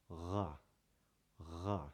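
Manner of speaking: ra made in the throat against the uvula, French style; uvular R. Shown as a demonstration. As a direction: show the Parisian throaty ra. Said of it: "touching what's called the uvula".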